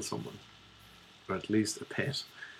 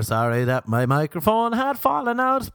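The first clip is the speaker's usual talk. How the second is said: ordinary talk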